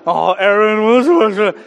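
loud, garbled imitation of grumbling kids; mock grumbling. Kids grumble against eldest brother Aaron, mock grumbling. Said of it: "They grumble against Aaron".

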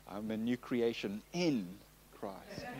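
"in" leaned on, pause before a soft ending; low and soft, singling out "in"; stress on IN. Shown as a demonstration. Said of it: "put the emphasis on a different word".

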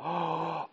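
mock horrified gasp; sharp intake of breath, acting out alarm; quiet re-enacted gasp of dismay; shocked gasp. IPad dropped on the floor.

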